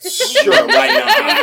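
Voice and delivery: high, loud laughing concession. Real, not acted. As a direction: answer through high laughter. Amused answer to joke question.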